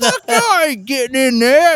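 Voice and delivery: imitated high, hollering outburst; loud refusal. Husband refusing to join the technician, high loud holler.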